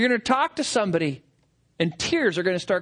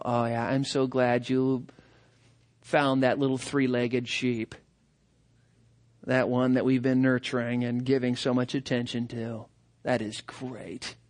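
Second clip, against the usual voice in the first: much lower and much softer, slowing down; tender, sincere relief over a lost lamb; heartfelt gladness; 10 semitones lower, 5 dB quieter than usual. Acted. Fellow shepherd to the finder, softly glad.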